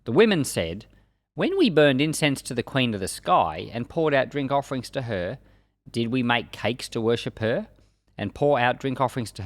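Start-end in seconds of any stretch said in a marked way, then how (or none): none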